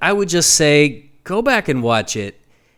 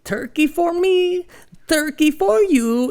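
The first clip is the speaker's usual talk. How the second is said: high, slow silly singsong; high goofy Sandler voice; slower than usual. Retold or imitated. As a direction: sing it high like a goofy kid. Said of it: "he'd be like".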